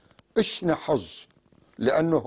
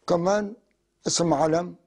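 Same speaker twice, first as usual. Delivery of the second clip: usual speaking voice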